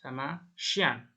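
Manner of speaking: "xian" read slowly as French chien, sh-yan with a nasal ending. Shown as a demonstration. Teacher slowly models "chien" as an example of ch. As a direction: draw out "xian" slowly, sh start and nasal end. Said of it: "Jadi contohnya ini ada".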